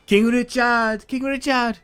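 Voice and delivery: high, loud put-on cry for the king; frantic calling voice. A panicked ally calling King Richard, high put-on voice. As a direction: call out to King Richard in a shrill made-up voice. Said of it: "where all these accents are coming from".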